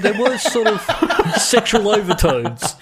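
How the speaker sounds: giggling through the words; laughing. Real, not acted. Friend laughing while describing the sexual songs. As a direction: laugh your way through an awkward admission.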